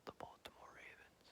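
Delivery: soft unvoiced whisper of the name; whispered like a secret. Shown as a demonstration. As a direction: breathe the team name in a hush. Trying out ways to say team name.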